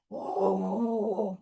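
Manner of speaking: drawn-out beast roar; big animal roar. Pretend. Unknown forest animal calls out, long roar. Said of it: "who's roaring so loudly".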